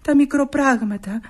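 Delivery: ordinary talk, nothing marked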